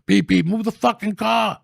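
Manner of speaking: high, loud honk-and-yell; retold yell at cop. Yelling at the cop blocking traffic. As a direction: blast the horn and bark at him. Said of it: "I remember beeping at him".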